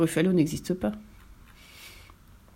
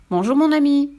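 higher, louder cheery hello; bright greeting; 7 semitones higher, 6 dB louder than usual. A narrator's reading. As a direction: call out a cheeky hello. Mouse greets the fox.

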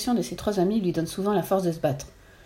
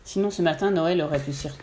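ordinary delivery

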